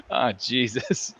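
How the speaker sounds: drawn-out stage Irish groan; slow mock Irish sigh. [name] caught out, slow mock Irish lament. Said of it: "Being Irish".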